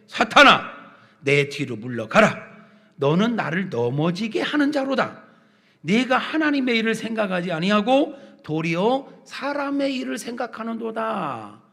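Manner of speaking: loud, commanding opening, then solemn declamation; stern scriptural rebuke. Acted. Jesus turning to rebuke Peter as Satan, stern proclamation.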